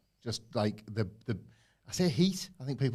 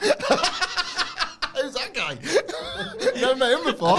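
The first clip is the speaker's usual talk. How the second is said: high, loud laughing Aussie voice; a broken Australian accent through laughter; Australian accent cracking into laughter; laughing mock Australian; 13 semitones higher, 6 dB louder, much slower than usual. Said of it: "in an Australian accent".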